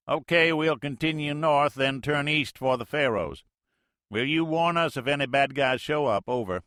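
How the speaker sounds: folksy Mississippi skipper talk, loud; Mississippi accent. Acted. Major answers the Navy plane in Mississippi accent, loud and folksy. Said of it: "The major's Mississippi accent".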